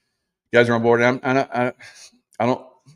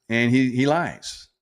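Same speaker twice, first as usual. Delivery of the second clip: ordinary talk, nothing marked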